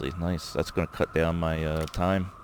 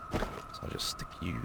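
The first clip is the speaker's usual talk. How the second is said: whisper; 12 dB quieter, faster than usual. Real, not acted. Quietly placing a light.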